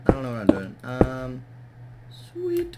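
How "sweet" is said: sudden high squeaky sweet; quick delighted high sweet; excited squeal. Real, not acted. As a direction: chirp it out, pleased. Pleased with her progress.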